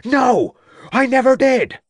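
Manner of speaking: shout. A narrator's reading. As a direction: yell the denial. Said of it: "shouted Troutbridge".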